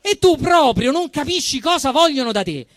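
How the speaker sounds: usual speaking voice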